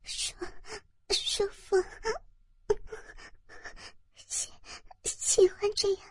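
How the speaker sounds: high, soft, halting murmur; breathy murmur. A narrator's reading. Narrator answers the partner in a halting murmur. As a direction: stammer it out softly between gasps. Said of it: "呢喃着说道".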